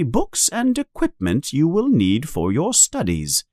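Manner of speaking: high, measured official announcement; formal letter reading. A narrator's reading. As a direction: read out a school letter formally.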